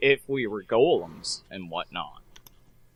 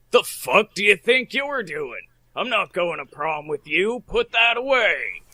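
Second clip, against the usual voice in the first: angry father; 7 semitones higher than usual. Acted.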